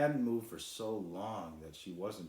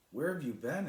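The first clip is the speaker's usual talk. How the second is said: high, louder worried call; worried question; 9 semitones higher, 6 dB louder than usual. A narrator's reading. Cloud asking the returning boy where he's been, high and anxious. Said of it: "asked Sky Dreamer".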